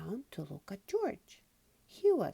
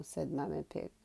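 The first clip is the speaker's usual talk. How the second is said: plain reading voice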